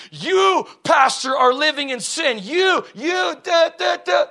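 loud, high mock denunciation; shouting false prophet. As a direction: yell it across the church at the pastor. Mimicking prophet denouncing pastor mid-service.

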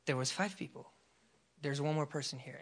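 soft, low inner prompting; hushed, understated voice of God; still small voice. Retold or imitated. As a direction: quote God quietly and evenly. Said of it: "just a still small voice".

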